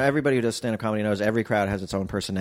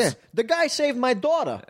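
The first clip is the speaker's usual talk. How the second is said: hammed-up working dad pleading; pleading crane worker; 13 semitones higher than usual. Dad whose daughter Spider-Man saved, high hammed-up plea.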